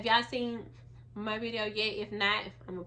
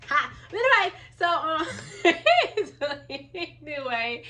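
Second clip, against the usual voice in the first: laughing; 6 semitones higher, 9 dB louder than usual. Real, not acted. Laughs after naming it.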